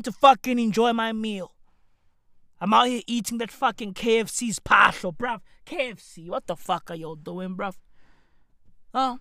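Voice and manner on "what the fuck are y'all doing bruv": ordinary talk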